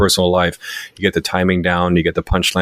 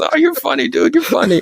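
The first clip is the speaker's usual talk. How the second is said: very high imitation of a tickled bro; high, amused retold voice; mock amusement. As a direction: pitch it way up, as if humoring a joker. Retelling brother's amused disbelief.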